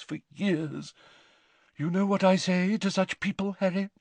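high, theatrical seer defending herself; Professor Trelawney, indignant. A narrator's reading. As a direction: protest grandly, slowing for emphasis.

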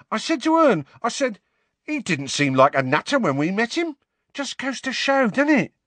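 high-pitched, colloquial gossipy conductor; chatty bus conductor. A narrator's reading. Stan Shunpike chatting to Harry on the bus, chattily.

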